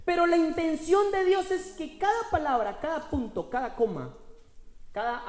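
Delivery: loud, high, building preacher's cry; preaching at full force. Acted. Pastor proclaiming to the congregation, loud and rising. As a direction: proclaim the power within, rising.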